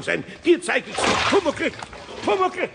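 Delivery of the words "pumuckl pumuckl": high calling out a name. Acted. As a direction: call for him across the workshop. Eder calls the imp.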